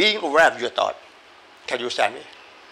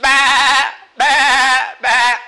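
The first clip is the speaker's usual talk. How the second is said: loud bleating goat; 9 semitones higher, 10 dB louder, much slower than usual. Shown as a demonstration. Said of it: "It doesn't mean a goat voice".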